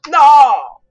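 "no" gritado con desesperación; grito desesperado. Real, not acted. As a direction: grita de frustración. Se cae en el juego. (grito desesperado)

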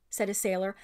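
usual speaking voice

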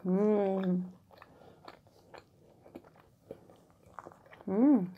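humming while chewing; chewing bread. Real, not acted. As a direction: mouth full of bread. Seen 0: Bit bread at -5; closed lips moving, chewing throughout labelled words.

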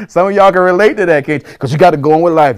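loud, high, amused shout; excited calling out. Real, not acted. Pastor to congregation who can relate, loud excited call. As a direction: call it out high to the whole room.

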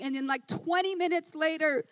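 high, loud, driving sermon voice; rising excited preacher building the story; full-force preaching. Acted. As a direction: preach it up to a roar.

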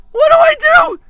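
high, desperate yelp; panicked cry. Real, not acted. Player out of candles, panicked cry. Stuck, repeats louder.

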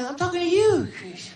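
gentle falsetto Michael telling him to believe it. Retold or imitated. Impersonating Michael reassuring starstruck Chris, high and airy.

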